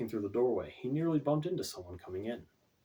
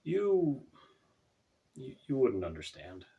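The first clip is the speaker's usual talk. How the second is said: stammering; 3 semitones higher, slower than usual. A narrator's reading. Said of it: "he managed".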